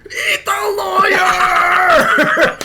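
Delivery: long, high, drawn-out mock cinema yell; imitated shout. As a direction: shout it out like an excited moviegoer. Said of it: "and just shouting".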